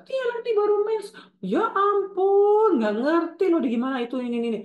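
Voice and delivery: very high, loud, exaggerated blabbing; gossiping mother. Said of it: "ibunya cerita". (ibu heboh membocorkan rahasia anak)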